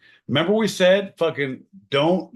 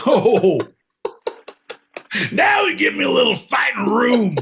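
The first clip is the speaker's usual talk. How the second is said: much higher, loud ranting shout; mock-heroic shout; 12 semitones higher, 7 dB louder than usual. Host rants theatrically at his co-hosts. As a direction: rant like a wronged hero.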